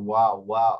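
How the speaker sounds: ওয়া, ওয়া with a rounded English w; English w sound. Shown as a demonstration. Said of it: "কিন্তু ইংরেজি যেটা".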